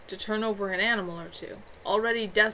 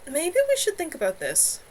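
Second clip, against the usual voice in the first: wary warning, higher; cautioning; 9 semitones higher, 4 dB louder than usual. A narrator's reading. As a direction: urge caution gently. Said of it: "Moira cautioned".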